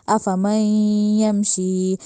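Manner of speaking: अफमन with the n hummed through the nose; nasalized recitation. Shown as a demonstration. Tajweed teacher showing ghunna to students, slow nasal chant. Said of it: "नाक में आवाज़ लेके जानी है".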